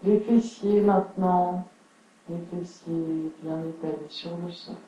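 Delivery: unhurried yoga nidra guidance with long pauses; guided relaxation. Acted. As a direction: settle listeners into stillness, phrase by phrase. (voix posée de relaxation guidée)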